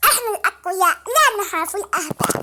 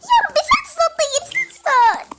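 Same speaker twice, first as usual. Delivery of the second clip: higher, tense, startled cry; frightened gasp; 8 semitones higher than usual. Real, not acted. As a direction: react in fear to a sound going past. The strange sound passes by.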